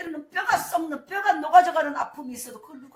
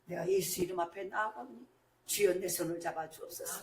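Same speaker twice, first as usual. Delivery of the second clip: quiet prayer; 5 semitones lower, 7 dB quieter than usual. Retold or imitated. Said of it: "기도하는 거예요".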